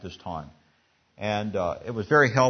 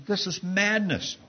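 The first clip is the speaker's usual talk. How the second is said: higher, disbelieving outburst; exclaiming; 7 semitones higher than usual. A narrator's reading. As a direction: exclaim it at the madness. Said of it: "he exclaims".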